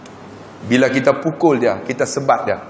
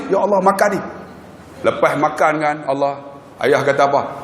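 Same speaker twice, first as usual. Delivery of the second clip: usual speaking voice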